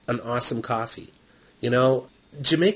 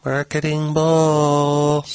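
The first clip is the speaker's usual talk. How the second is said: right in your ear; 5 semitones higher, 6 dB louder, much slower than usual. Real, not acted. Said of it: "speaking really close to the microphone now so I can whisper".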